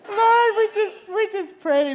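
high, loud, drawn-out plea to the Lord; impassioned prayer opening; fervent prayer. Real, not acted. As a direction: call out to God in prayer.

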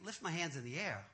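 low, soft, doubtful echo; puzzled inner thought. Retold or imitated. Man doubting the pastor's order to himself, quietly puzzled. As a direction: question the order under your voice. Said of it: "And I'm thinking".

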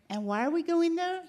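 high, disbelieving question; incredulous question. Retold or imitated. Telling the friend she doubts the bad restaurant, incredulous. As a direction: ask as if the plan makes no sense.

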